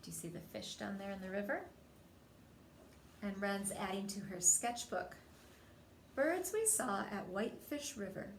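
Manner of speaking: ordinary delivery, nothing marked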